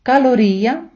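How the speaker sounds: калорія said slowly, stress on the second-to-last syllable; slow model word. Shown as a demonstration. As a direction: pronounce калорія carefully for repeating. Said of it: "я буду читати повільно, робити паузу".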